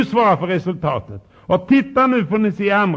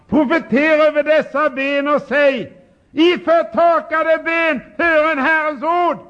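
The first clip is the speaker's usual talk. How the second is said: voice of God commanding; 9 semitones higher, 4 dB louder than usual. Retold or imitated. Preacher voices God commanding the prophet loudly. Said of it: "Då sa han".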